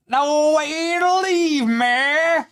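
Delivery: high, slow mock gospel preacher; mocking Hillary's sermon. Host mocking Hillary's church speech, high mock preacher. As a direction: drag the words out like a sermon parody. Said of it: "it's like, lady, we've heard the way".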